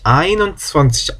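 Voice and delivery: slow, careful German numeral; slow demo. Shown as a demonstration. Teacher slowly models the German numeral. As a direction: spell it out slowly. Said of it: "아인 하고 합쳐진다".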